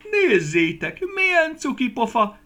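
high, loud cooing cry; delighted cry. Acted. Fat peach lady sees Guszti, high loud gushing cry. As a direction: gush over the cute little cherry. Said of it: "kiáltotta a legkövérebb barackasszonyság".

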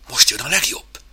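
usual speaking voice

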